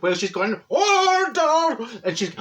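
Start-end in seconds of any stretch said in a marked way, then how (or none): 0.7-1.9 long, high, loud Order in another voice; shouting order. Retold or imitated. Fed-up judge shouts down the chatty juror. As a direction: stretch the yell out in a different voice.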